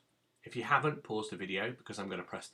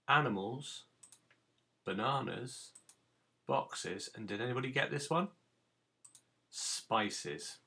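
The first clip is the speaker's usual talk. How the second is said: ordinary talk, nothing marked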